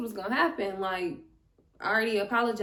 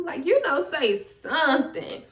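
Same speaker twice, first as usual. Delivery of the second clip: higher, louder push to speak up; exasperated urging; 5 semitones higher, 5 dB louder than usual. Retold or imitated. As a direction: egg a friend on emphatically. Urging shy friend to speak.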